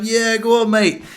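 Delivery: a hint of a pub crowd egging him on; cheering bystanders. Bystanders applauding his car repair, mock cheer. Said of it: "there were people going".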